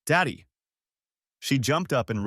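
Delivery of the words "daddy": put-on higher, lightly excited child's cry; a restrained narrated squeal; mild shriek. A narrator's reading. Daughter greeting her father, mildly shrieking. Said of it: "Meadow shrieked".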